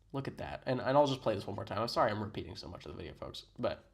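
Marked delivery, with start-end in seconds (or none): none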